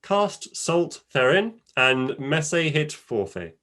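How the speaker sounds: slow, archaic recipe reading; Old English reading. Shown as a demonstration. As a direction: chant it like an old cookbook. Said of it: "I'll read an original recipe in Old English".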